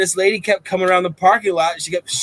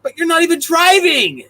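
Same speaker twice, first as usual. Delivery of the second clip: retort; 10 semitones higher, 4 dB louder, slower than usual. Retold or imitated. Retorting to the lady.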